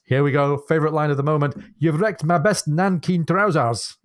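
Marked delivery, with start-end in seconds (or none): 1.8-4.0 high, slow, outraged mock Scotsman; terrible Scottish accent. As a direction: ham up a Scottish lament, drawn out. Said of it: "at my terrible accent".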